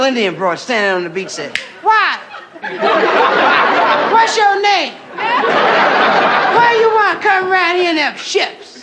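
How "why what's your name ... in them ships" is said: high, slow sassy voice; sassy girl. Acted. Girl on the beach challenging Chris, high sassy voice. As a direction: sass the stranger off the beach.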